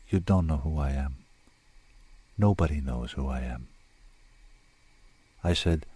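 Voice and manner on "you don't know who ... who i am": low, subdued and hopeless; miserable. A narrator's reading. Woman who has stopped crying to her rescuer, low misery. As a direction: lower the voice, drained after crying.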